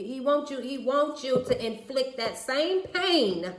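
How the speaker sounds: loud, high, stretched-out climax; impassioned holler; emphatic rant. Real, not acted. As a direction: drive it home, drawing out the last word. Rant building to its point.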